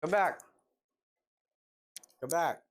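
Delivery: shouting from across the room; calling out. Real, not acted. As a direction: call her back loudly. Calling friend back from other room.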